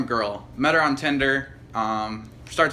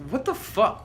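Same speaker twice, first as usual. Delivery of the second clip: high-pitched retold outburst; exasperated disbelief; 11 semitones higher, slower than usual. Guy replays his reaction to his date's questions, exasperated. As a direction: act out baffled exasperation, pitched way up.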